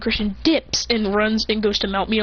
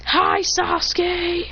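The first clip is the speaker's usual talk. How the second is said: high, slow, drawn-out hello; mock greeting; 8 semitones higher, much slower than usual. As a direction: sing out the greeting in a put-on voice. Smoke message greets friend.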